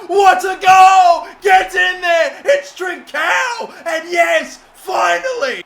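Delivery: high, loud, slow drawn-out celebration; ecstatic voice cracking scream. Real, not acted. Gamer celebrating the winning goal, voice cracking. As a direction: scream with joy till the voice cracks. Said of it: "voice cracking every damn second".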